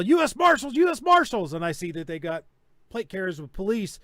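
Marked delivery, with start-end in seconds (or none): 0.0-1.5 a mock officer's shout, higher and louder; retold yelling. Marshals announcing themselves at the door, retold mock shout. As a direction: bark it like a raid team at the door. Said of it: "they're starting to yell".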